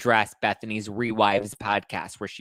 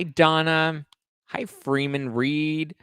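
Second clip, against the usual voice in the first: ordinary talk, nothing marked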